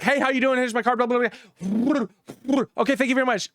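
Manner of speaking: hurried realtor. Retold or imitated. Rushed realtor mimicked high.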